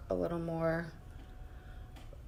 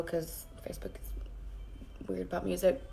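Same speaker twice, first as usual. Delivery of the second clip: whispering; 8 dB quieter than usual. Real, not acted. Aside about the music.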